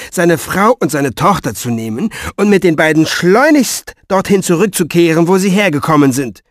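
forceful, emphatic lecturing; testy, insistent argument, easing towards the end; irate old writer. Acted. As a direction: set the intruder straight, heatedly.

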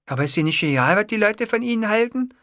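high-pitched secretary voice. A narrator's reading. Secretary asking her boss about a ringtone, high character voice.

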